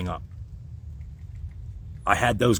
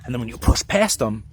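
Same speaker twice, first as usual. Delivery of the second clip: usual speaking voice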